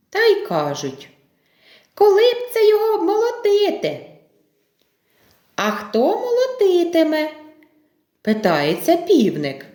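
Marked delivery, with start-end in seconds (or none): 1.9-4.1 high, quick little mouse voice; little mice. Acted. Mice answer the rooster, little mouse voice. 5.6-7.3 questioning rooster. Acted. Rooster asks the mice.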